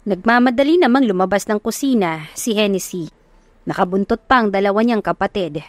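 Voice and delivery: usual speaking voice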